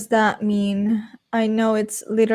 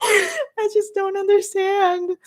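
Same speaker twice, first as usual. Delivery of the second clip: baffled wail; 7 semitones higher, 3 dB quieter, slower than usual. Real, not acted. Overwhelmed after retelling absurd scene.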